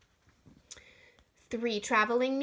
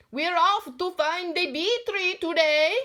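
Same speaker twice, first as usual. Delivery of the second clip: loud, higher shout; 6 semitones higher, 6 dB louder than usual. Acted. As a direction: shout it out as you run past. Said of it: "Gramps shouted".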